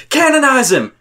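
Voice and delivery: high-pitched imitated call to attack; mock rallying cry. Player rallying buddies to jump someone, mock rallying cry. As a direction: call the team in, high and hammed-up.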